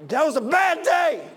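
high, loud outburst; impassioned cry. Real, not acted. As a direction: preach it out with real anguish. Preacher cries out over a death.

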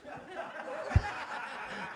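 high wheezing giggle; high laugh. Real, not acted. Preacher cracking up at his own joke, high wheeze. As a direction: let a squeaky laugh escape.

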